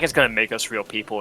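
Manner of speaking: lightly voiced menacing leader; imitating Xemnas, low and ominous; ominous deep voice. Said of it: "that really ominous deep voice that he can do".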